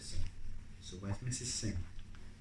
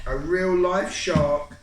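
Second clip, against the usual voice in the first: excited; 7 semitones higher, 9 dB louder, slower than usual. Acted.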